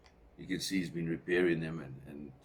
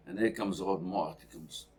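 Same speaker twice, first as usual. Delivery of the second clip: normal talking voice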